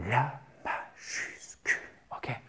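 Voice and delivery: very soft, voiceless whisper; whispered reminder. Real, not acted. Teacher reminding learners about capital letters, whispered.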